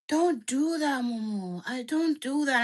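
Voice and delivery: lightly done Nigerian accent imitating dad; a hint of a Nigerian dad pleading. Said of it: "Your dad sounds Nigerian".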